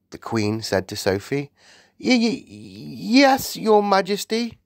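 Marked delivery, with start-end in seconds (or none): 2.0-4.6 slow, stammering, high and timid; nervous stammer. A narrator's reading. Girl timidly answering the Queen. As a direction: stutter it out in awe. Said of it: "Sophie murmured".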